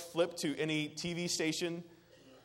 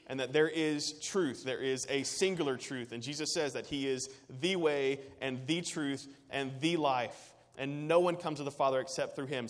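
ordinary talk, nothing marked

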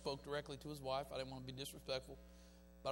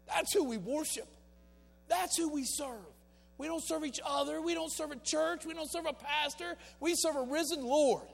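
preaching at full force; 12 semitones higher, 11 dB louder than usual. Acted. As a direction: drive each line home to the crowd. Climactic preaching of Romans 8.

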